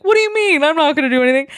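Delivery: high-pitched feigned innocence; mock-innocent protest. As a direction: play innocent, voice jumping way up. Kirk feigning innocence in retold story.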